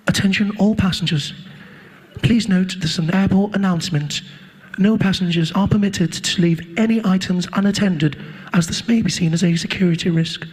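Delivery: calm monotone PA announcement; calm airport announcer. Retold or imitated. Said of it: "You'll hear that woman come on the system".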